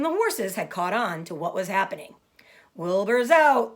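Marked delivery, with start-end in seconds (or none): 2.7-3.7 slow, louder announcement; slow excited barnyard news; excited animals. Acted. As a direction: spell out the big news. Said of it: "they said".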